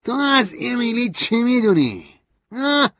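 high, loud, slow furious question; angry. A narrator's reading. Stanley demands what narrator knows of Emily, angry. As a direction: demand it in rage. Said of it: "با خشم پرسید".

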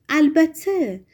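put-on young cousin turning on Arkady, surprised. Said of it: "با تعجب گفت".

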